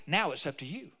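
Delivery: low, softened challenge; quiet. Real, not acted. Preacher leaves the choice to the congregation, quietly. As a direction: hand it over gently and low.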